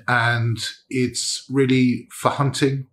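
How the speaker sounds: usual speaking voice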